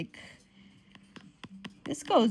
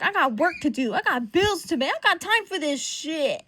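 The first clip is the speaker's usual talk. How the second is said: higher, emphatic mock complaint; fed-up protest; 6 semitones higher, 4 dB louder than usual. Ben protesting that he has work and bills, fed-up. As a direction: act out an exasperated brush-off. Fed-up character's quote acted out.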